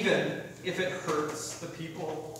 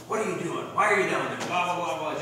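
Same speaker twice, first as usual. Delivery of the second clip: put-on raised, louder barking at a small kid; mock sideline dad yelling, higher and louder; mock angry yell; 4 semitones higher, 6 dB louder than usual. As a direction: play the dad scolding from the mat side. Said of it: "the dad just starts yelling at him".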